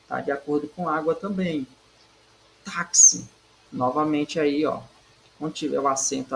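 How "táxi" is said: "Táxi" punched loud on TÁ; teacher's emphatic "Táxi", TÁ stressed. Shown as a demonstration. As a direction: hit the first syllable of "Táxi". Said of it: "penúltima sílaba é o táxi".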